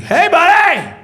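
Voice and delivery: high, loud hearty hello; imitated over-friendly shout; big-smile greeting. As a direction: greet an old pal across the room. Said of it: "comes out with a big smile".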